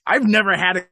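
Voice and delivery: lightly acted lawyer's protest; mock exasperated complaint. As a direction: complain straight at a difficult client. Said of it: "he even says it to their face".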